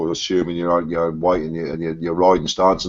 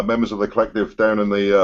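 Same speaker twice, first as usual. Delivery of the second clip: normal talking voice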